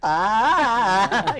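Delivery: high loud mock gasp for air. Asthmatic pupil, a high loud mock gasp. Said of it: "And I'm like".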